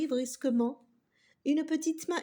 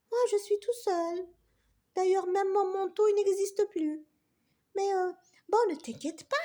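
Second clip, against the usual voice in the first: lightly voiced high kind little character; little button character; 6 semitones higher than usual. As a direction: comfort the sad friend, high and kind. Voix du petit bouton. (petit personnage aigu et gentil)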